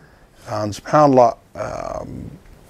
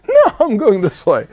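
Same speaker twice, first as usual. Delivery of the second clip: put-on higher, laughing defiant old woman; stubborn old aunt; 9 semitones higher than usual. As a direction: refuse the angel with a chuckle. Aunt refuses angel, laughing.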